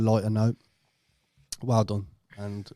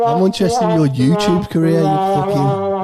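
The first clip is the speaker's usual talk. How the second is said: loud, higher mock repeat while laughing; 7 semitones higher, 12 dB louder than usual. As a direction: parrot him, laughing loudly. Mocking Paul's line, laughing.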